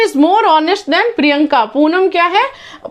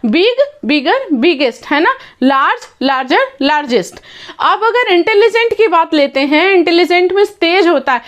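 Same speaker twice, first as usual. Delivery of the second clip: ordinary talk, nothing marked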